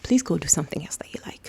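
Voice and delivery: soft, hurried plea; quick plea. Acted. Body begging its owner to stop, quick soft plea. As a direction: rush it softly, an urgent request. Body's pleading, voiced quickly.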